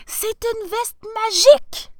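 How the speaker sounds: high, loud, excited yell; triumphant shout. A narrator's reading. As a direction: shout the discovery across the garden. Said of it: "lui cria le professeur".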